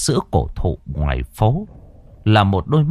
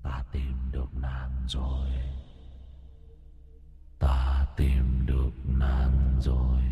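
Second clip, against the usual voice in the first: low, slow, hollow ghostly murmur; eerie ghost voice; 8 semitones lower, 10 dB quieter, slower than usual. A narrator's reading. A ghost calls out to the mother, low and eerie. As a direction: drag each word out like a spirit calling.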